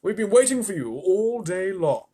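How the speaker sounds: grasshopper voice. Acted. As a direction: welcome him warmly. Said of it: "the old green grasshopper said".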